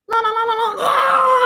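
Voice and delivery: very high ringing, swelling loud; phone ring imitation. Phone rings off the hook, high insistent trill. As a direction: mimic a phone that will not stop.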